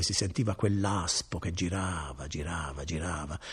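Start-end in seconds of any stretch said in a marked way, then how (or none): 1.4-3.4 each girava softer and lower, dying away; fading repetition. A narrator's reading. Bortolo recalling Lucia's spinning wheel to Renzo, fading to near silence. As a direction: let the turning wheel fade into silence.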